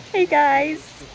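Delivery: ordinary delivery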